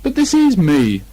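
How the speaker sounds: high, loud and slow; very surprised. Acted. Pooh insists it is him, loud and surprised. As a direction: bear can't believe it. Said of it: "very much surprised".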